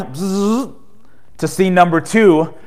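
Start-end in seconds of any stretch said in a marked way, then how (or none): 0.0-0.7 quick buzzing rewind noise. Pretend. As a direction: buzz like a tape rewinding. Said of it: "We're gonna rewind".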